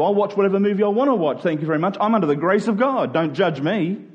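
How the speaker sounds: normal talking voice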